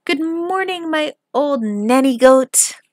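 loud, slow teasing call; high-pitched, hammed-up greeting; crying out. A narrator's reading. Robber girl teasing her mother, loud and slow.

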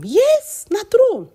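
high, loud eager yes na true; excited agreement. Real, not acted. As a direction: jump in to cheer the priest on.

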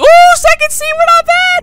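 giddy high-pitched brag; gleeful. Real, not acted. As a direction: squeak it out with giddy joy. Delighted by his team.

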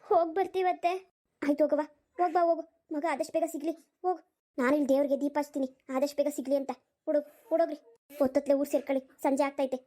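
low, brisk village aunt urging them off; an elderly aunt hurrying the young couple along; old aunt voice. Acted.

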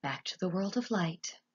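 soft, hushed murmur; quiet narrated whisper. A narrator's reading. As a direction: say it softly, almost to yourself. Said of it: "Gregory whispered".

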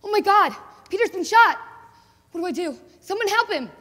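high, loud shrieks of horror; panicked screaming. Acted. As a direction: scream his name as he falls. Comrade shot beside her in battle.

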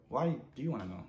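normal talking voice

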